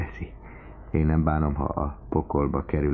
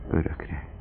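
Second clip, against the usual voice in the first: usual speaking voice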